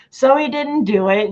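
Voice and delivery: ordinary talk, nothing marked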